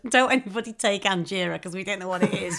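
talking through laughter; cracking up mid-sentence; laughing. Real, not acted. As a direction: laugh while joking. Mocking the ad while laughing.

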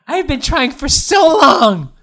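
high, loud, drawn-out comic wail; hammed-up cry of a rejected suitor; Jorah's lament. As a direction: whine it through laughter, stretching it out. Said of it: "And Jorah's, like, going".

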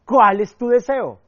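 loud, slow, insistent question; drawn-out, emphatic demand from a genie; impatient genie. Acted. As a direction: press for an answer as a genie losing patience. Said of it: "Oye, bueno, tengo que irme".

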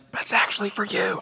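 whispered aside. Retold or imitated. The Lord tells the preacher privately, whispered. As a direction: hush it like a secret from the Lord. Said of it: "The Lord's been saying".

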